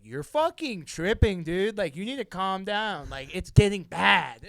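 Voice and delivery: loud panicked inner scolding; inner voice yelling. Retold or imitated. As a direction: snap at yourself to calm down.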